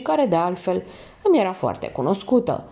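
usual speaking voice

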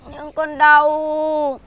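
slow, loud pained wail. Acted. Son wails to his father that it hurts, slow loud wail. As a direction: wail to be carried. Boy pleads to be carried.

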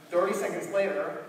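ordinary talk, nothing marked

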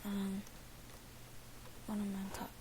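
unvoiced cut-off whisper. Real, not acted. Gamer whispering to himself in a tense moment. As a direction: hiss it under your breath. Gamer cursing under breath.

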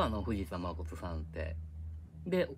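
normal talking voice

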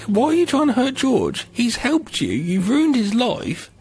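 high-pitched mock pleading; indignant protest. Acting out own protest at spirit.

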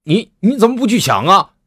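indignant shout at the monk; angry roar. Acted. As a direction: roar it in outrage at the fine. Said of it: "赵大同怒吼道".

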